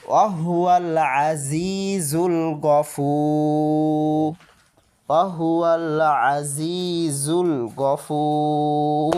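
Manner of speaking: slow melodic tilawat, long held final vowel; Quranic recitation. Shown as a demonstration. Teacher reciting the phrase for students, slow tilawat. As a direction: chant it slowly, stretching Gafur.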